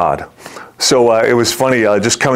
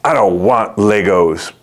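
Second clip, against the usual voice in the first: slow, sulky child imitation; sulky little kid; 2 semitones lower, slower than usual. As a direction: drag it out like an unimpressed kid.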